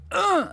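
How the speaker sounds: high, loud grunt on the serve; effort grunt. Retold or imitated. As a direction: grunt hard as you serve. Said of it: "I'd go".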